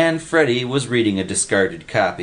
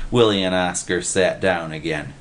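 ordinary delivery

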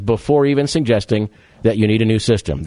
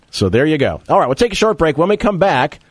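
normal talking voice

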